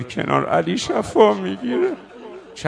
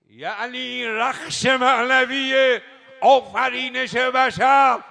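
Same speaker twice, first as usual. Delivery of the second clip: slow, loud, raised sermon voice; preaching at full force; 6 semitones higher, 5 dB louder, much slower than usual. Acted. Preacher explaining the praise to the congregation, slow and loud. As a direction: drive each phrase home to the hall.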